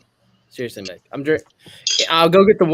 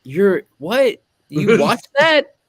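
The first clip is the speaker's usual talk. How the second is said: baffled disbelief, rising higher and drawn out; incredulous; 5 semitones higher, much slower than usual. Retold or imitated. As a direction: act out being stunned that anyone watches. Storyteller acts out disbelief at fans.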